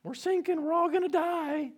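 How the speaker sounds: high panicked cry, mock terror; panicked disciples. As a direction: cry out like men about to drown. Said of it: "they start freaking out".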